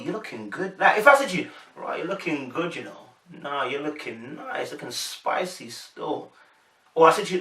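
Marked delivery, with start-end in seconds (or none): 0.0-0.8 soft admiring murmur with a lift at the end; flirty intonation. Acted. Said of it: "it's the intonation". 1.7-6.3 slow, soft, lingering compliment; low-key smooth flirting; flirty intonation. Acted. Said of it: "the mannerism of the body".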